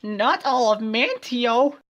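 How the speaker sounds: very high, loud, slow wail; cried. Acted. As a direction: draw it out in a shrieking cry. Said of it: "Twilit cried".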